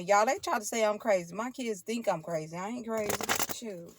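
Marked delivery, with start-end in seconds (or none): none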